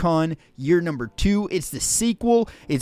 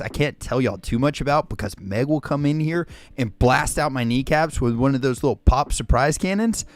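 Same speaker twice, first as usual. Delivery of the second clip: normal talking voice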